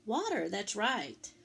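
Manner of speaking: ordinary delivery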